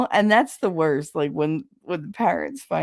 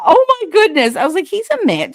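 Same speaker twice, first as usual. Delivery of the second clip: much higher, louder mock shock; mock shocked gasp; 12 semitones higher, 9 dB louder than usual. Teen reacting to grandma's gossip, shocked and loud. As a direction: play the scandalized teenager.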